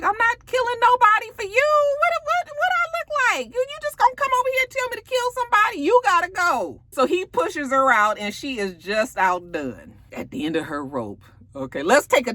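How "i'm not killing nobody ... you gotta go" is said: very high, mock-outraged squawk; indignant refusal. Tommy refuses to kill for her, acted high-pitched outrage. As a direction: act out an exasperated man throwing someone out.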